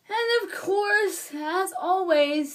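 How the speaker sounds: drawn-out, high, singsong exasperation; exasperated emphasis. Real, not acted. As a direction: stretch each word out, loud and high. Sarcastic grumble about Cena winning.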